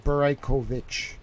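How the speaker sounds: "Beraikovich" as bur-eye-KOH-vich; trying a pronunciation. Shown as a demonstration. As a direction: test out "Beraikovich" with a full "koh". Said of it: "I wonder if it's".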